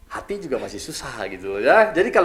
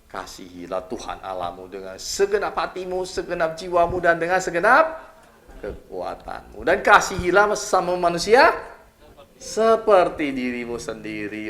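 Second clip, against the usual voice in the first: rising, louder preaching of the verse; scripture proclamation; 6 semitones higher, 5 dB louder, slower than usual. Acted. Preacher reciting the commandment to the congregation, building loud and proclaiming. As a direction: proclaim the commandment with swelling force.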